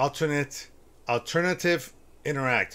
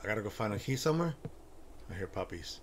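ordinary talk

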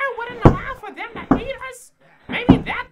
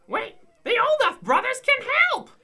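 ordinary talk

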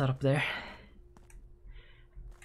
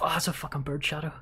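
ordinary delivery

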